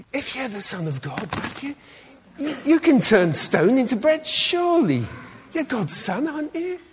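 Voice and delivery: high, wheedling tempter voice; coaxing devil voice. Acted. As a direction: coax him like a sly devil. Preacher voices tempting devil to boy.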